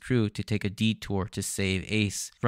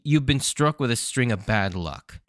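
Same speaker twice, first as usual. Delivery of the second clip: normal talking voice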